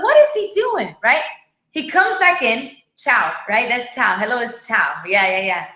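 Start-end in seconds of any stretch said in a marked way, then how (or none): 0.0-0.9 much higher, bewildered question; retelling her confusion in a high voice; puzzled. Retold or imitated. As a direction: sound baffled as you watch him. Wife puzzled as husband leaves car.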